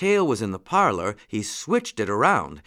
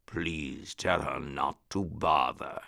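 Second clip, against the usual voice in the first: low, slow, hushed lion voice; lion whispering; 6 semitones lower, 7 dB quieter, slower than usual. A narrator's reading. Lion privately to the rabbit about dinner, whispering. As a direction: drop low and soft, drawing it out. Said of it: "whispered the lion".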